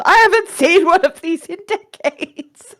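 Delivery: high-pitched, gushing exclamation; acted marvelling at a rare find; delighted exclamation. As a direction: burst out high with amazement. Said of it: "And Twilly is just".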